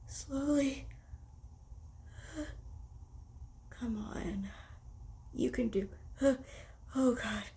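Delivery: soft unvoiced whisper to self. Real, not acted. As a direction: whisper while concentrating. Carefully tickling nose with tissue.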